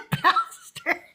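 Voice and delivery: high squeal of laughter into the word; can't hold the giggle in; laughing. Real, not acted. Joking about the Methobaptist pastor.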